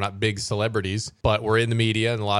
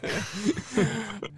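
quiet high chuckle; a soft, airy laugh; 9 semitones higher, 6 dB quieter than usual. Real, not acted. Laughing at the joke.

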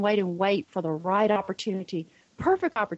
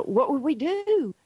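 put-on higher, drawn-out child's question; animated child; 7 semitones higher than usual. Son asks mother about the burning bridge, imitated animation. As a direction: imitate a worked-up boy, stretching the question slowly. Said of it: "he was so animated".

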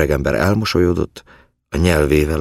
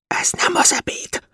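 urgent voiceless whisper; hushed, fully whispered warning. A narrator's reading. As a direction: whisper it under your breath. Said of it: "suttogott a fiú".

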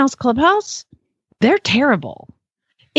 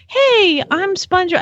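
high, perky cartoon greeting; SpongeBob; 8 semitones higher than usual. Mom retelling a birthday call from a cartoon character, high-pitched imitation. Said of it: "the actual voice of SpongeBob".

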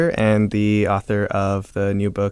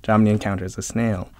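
ordinary talk, nothing marked

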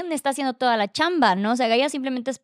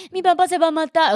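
put-on higher, louder child's line; imagined child; 6 semitones higher, 5 dB louder than usual. Imagining the girl growing up with this, childlike quote. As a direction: lift the pitch as if quoting a little girl. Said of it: "la pobre niña creciendo con que".

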